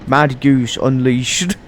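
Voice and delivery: usual speaking voice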